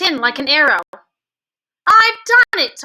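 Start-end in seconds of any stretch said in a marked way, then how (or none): 1.9-2.8 much higher, louder triumphant cry; excited cry. A narrator's reading. Boy bursting in to tell grandmother of success, excited. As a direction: burst in with the good news. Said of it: "I cried".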